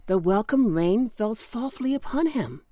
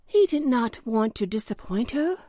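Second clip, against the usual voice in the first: plain reading voice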